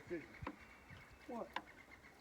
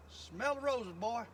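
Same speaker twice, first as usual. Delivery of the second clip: lightly imitated mama, raised pitch and volume; Miss Sarah Cummerlander; 5 semitones higher, 6 dB louder, slower than usual. Angler quoting his mama's old saying, lightly imitating her. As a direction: quote mama's saying a little louder and higher. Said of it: "like my mama, Miss Sarah Cummerlander, used to say".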